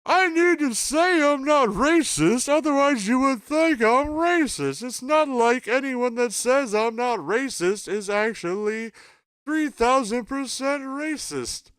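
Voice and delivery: very high, loud, slow Seinfeld whine; terrible Jerry Seinfeld. Retold or imitated. Streamer doing a bad Seinfeld rant to stream chat, high and loud. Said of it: "That's a terrible Jerry Seinfeld impression".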